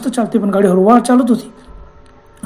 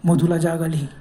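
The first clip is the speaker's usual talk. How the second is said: ordinary delivery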